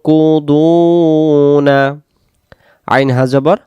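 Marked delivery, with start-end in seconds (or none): 0.0-1.7 slow, drawn-out chanted recitation; Quran recitation. Shown as a demonstration. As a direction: stretch every vowel like tilawat. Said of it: "teacher demonstrates Quran recitation". (ধীর সুরেলা তিলাওয়াত)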